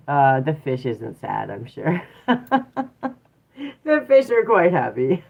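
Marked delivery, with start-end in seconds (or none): 2.3-3.1 high laugh. Real, not acted. Laughing at fish joke.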